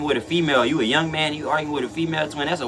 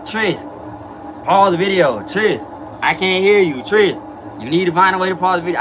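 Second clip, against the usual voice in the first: lightly voiced pestering viewer, raised pitch; nagging viewers; 3 semitones higher than usual. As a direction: call out like an impatient commenter. Voicing viewers' pestering comments.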